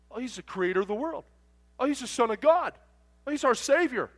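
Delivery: high voice rising in volume; mounting mock certainty; confident trucker character. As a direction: imitate a keen pupil reeling off answers. Trucker reels off catechism answers.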